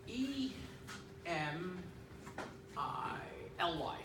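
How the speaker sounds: one letter at a time, long pauses between; halting eye-chart reading. Retold or imitated. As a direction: spell it out slowly and deliberately, as at an eye test.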